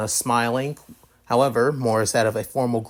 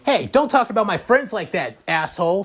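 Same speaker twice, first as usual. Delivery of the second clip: higher, heated comeback; indignant, pitched-up quarrel voice; angry retort; 7 semitones higher, 3 dB louder than usual. Acted. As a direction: snap back in defence of your friends. Issei defends friends in quarrel.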